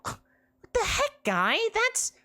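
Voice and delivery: high, wounded squawk; stung by his words; harpy hurt by the insult. Acted. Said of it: "She appears hurt".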